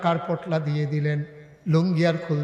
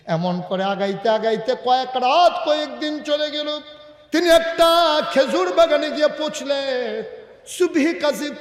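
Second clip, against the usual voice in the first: high-pitched, rising sermon narration; impassioned preaching; 10 semitones higher, 5 dB louder than usual. Real, not acted. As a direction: build the journey with a lifted voice. Preacher builds the journey.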